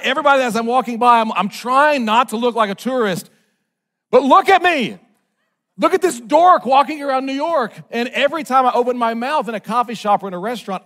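ordinary talk

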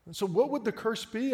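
normal talking voice

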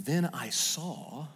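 hushed wonder. Retold or imitated. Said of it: "He says".

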